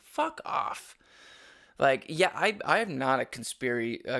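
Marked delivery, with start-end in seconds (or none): 0.0-1.0 dismissive. Retold or imitated. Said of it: "I was just like".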